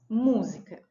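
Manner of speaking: ordinary talk, nothing marked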